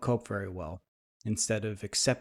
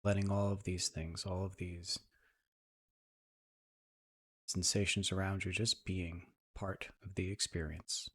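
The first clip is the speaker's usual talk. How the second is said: soft, even meditation voice with long pauses; guided meditation; 4 dB quieter than usual. Acted. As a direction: ease listeners into calm, leaving space between phrases.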